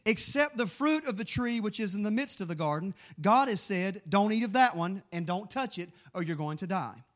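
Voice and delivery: loud, deliberate, emphatic Bible reading; preached scripture reading. Acted. Preacher reads Eve and the serpent in Genesis, loud preaching. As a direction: drive each phrase out to the congregation.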